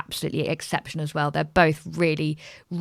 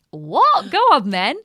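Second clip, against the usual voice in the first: eager surprise; 6 semitones higher, 7 dB louder, slower than usual. Retold or imitated. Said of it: "I was like".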